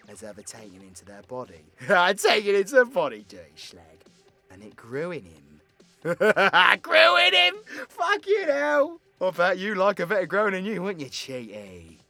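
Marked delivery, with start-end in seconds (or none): 2.2-3.2 loud, higher, quick laughing echo; tickled and incredulous; amused outburst. Acted. Cracks up at own innuendo. 6.8-9.0 high, loud shout through laughter; hysterical, high-pitched outburst; uproarious laughing. Acted. Laughs loudly at own innuendo. 9.2-12.0 loud, higher, quick ribbing; raucous teasing, riding on the laugh; lewd teasing. Acted. Ribbing the listener with innuendo.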